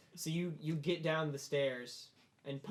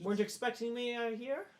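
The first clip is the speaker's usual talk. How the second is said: higher-pitched courteous visitor voice; puzzled visiting dignitary; 9 semitones higher, 4 dB louder than usual. Acted. Visitor to startled host, puzzled high voice. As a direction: ask it high, in character.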